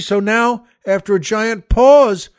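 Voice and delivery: normal talking voice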